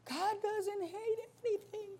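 high, hushed mock-naive voice; tiny high-pitched protest; mocking objector. Mocking an objector mid verse.

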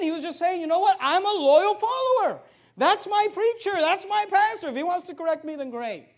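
high, loud, heartfelt loyalty; fervent preaching. Retold or imitated. Preacher voicing the usher's loyalty, fervent and loud. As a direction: proclaim loyalty with full force. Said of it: "here's what he was saying".

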